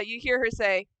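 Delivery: normal talking voice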